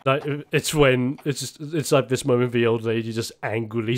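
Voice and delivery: normal talking voice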